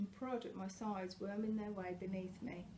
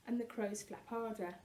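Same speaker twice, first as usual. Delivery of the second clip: ordinary delivery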